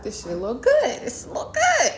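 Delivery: excited squeal rising very high on "good"; hyped reaction. Retold or imitated. Vlogger recalls getting hooked on the show, hyped. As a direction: act out getting hooked, pitch leaping up.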